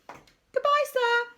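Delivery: high, singsong taunting farewell; calling out. A narrator's reading. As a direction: call it across in a high voice. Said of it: "called out Rebel".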